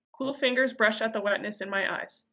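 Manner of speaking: plain reading voice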